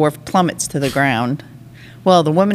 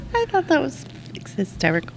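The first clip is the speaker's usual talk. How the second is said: laughing. Real, not acted. Still giggling over his own joke, trailing off. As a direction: laugh it out, then let it die down.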